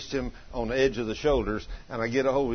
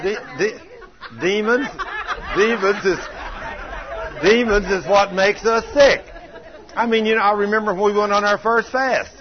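fiery preaching; 6 semitones higher, 5 dB louder than usual. Acted. Preacher driving home that demons make us sick, loud fervent preaching. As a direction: preach it hard to the congregation.

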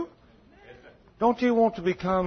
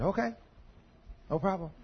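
soft giving in; 4 semitones higher, 8 dB quieter than usual. Retold or imitated. Soft conciliatory reply.

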